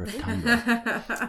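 slow, high laughing warning. Real, not acted. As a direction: drag it out through laughter.

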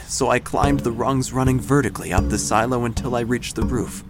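ordinary delivery

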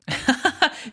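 high acted giggle; tittering high-pitched laugh; creature laugh. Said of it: "She laughs".

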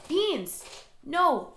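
high sharp call at the pet; calling the cat. Real, not acted. Scolding her cat Beans for climbing near the camera, high sharp call. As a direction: stop the cat mid-mischief.

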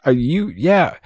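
usual speaking voice

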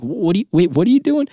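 rising panic at a reckless brother; alarmed disbelief. Retold or imitated. Younger brother panicking as his brother runs off, high alarmed cry.

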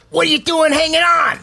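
high squeaky little creature voice. Acted. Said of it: "a squeaky voice say".

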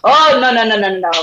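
loud mock refusal; dismissive interruption. Therapist cuts guest off over menu, dismissive. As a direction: imitate someone cutting in with a string of noes.

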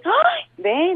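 high bright "Ay, ve"; the brain realizing it was fine; light Colombian exclamation; mock surprise. Brain voiced, relieved surprise.

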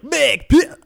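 high, loud, cut short; cut-off troll boss. Retold or imitated. Friend mocks the boss dying mid-line, high and loud. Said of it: "he's like".

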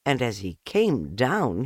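ordinary delivery

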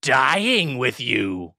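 very slow ghoulish pun delivery; Crypt Keeper. Retold or imitated. Ghoul delivering the punchline, very slow Crypt Keeper voice. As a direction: draw out the first word like a gleeful ghoul. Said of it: "That impression that you just did".

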